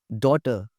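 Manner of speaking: "डॉटर" with a rounded aw vowel; exaggerated correct pronunciation. Shown as a demonstration. Teacher correcting learners, "डॉटर" with rounded aw. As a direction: open "डॉटर" into aw, r left silent. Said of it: "ज़रा बढ़ा के बोलना है".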